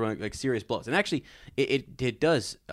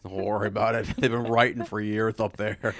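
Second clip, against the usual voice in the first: usual speaking voice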